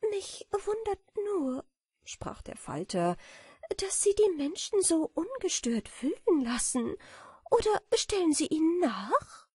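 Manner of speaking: put-on high, light, dreamy girlish voice; a naive little butterfly imagining honey; sweet and high; innocent butterfly. A narrator's reading. Butterfly questions beetle.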